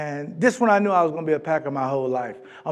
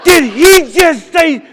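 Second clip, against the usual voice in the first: high, loud, slow disbelief; shocked shout; 11 semitones higher, 12 dB louder, slower than usual. Retold or imitated. Girlfriend on the plane hearing the cold forecast, shocked shout. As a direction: shriek it in disbelief. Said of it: "Her head turned all the way around".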